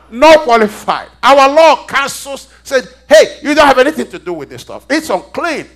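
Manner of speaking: loud, high voice of the law rejecting; forceful preaching. Acted. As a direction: lay down the law to the congregation.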